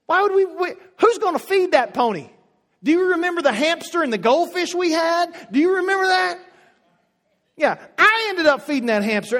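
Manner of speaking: loud, high, exasperated refusal; shutdown parent. Retold or imitated. Parent refusing a child's pony, loud and exasperated. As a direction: shut the kid down hard. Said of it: "by the shutdown mode and say".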